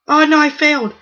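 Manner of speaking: loud, high cry of dismay; dismayed shout. Real, not acted. Gamer groaning loudly as his attack fails, dismayed shout. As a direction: wail at your own mistake.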